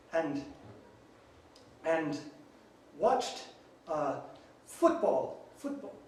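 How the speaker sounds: put-on British accent. Telling the border guard a story in British accent. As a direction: overdo British words, self-correcting. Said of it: "in your very own accent".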